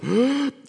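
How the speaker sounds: sharp, loud gulp of air; imitated gasp. Little boy pulled up from the water, mimicked gasp. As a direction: mimic a child coming up for air.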